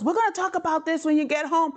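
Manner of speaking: lightly voiced mom's warning; mother's warning. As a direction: warn her like a mom.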